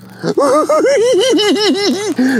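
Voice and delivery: high, loud delighted laugh; real triumphant laugh; gleeful laughter. Real, not acted. Fish landed, laughing.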